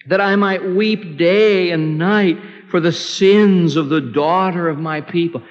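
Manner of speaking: slow, high prophetic lament; solemn, mournful declaiming; Jeremiah's lament. Acted. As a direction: draw out the scripture like a grieving cry. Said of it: "Jeremiah said that".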